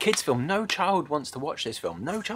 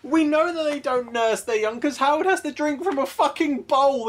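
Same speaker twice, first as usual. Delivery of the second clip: high, whining, near-crying rant; rising mock despair; exasperated rant; 11 semitones higher, 4 dB louder than usual. Real, not acted. Mock-distressed rant about ducks.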